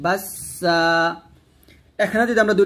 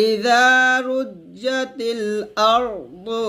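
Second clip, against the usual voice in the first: ইযা raised, melodic recital; recitation demo; 5 semitones higher, much slower than usual. Shown as a demonstration. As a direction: model the verse. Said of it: "মোটা করবেন". (তিলাওয়াত করে দেখানো)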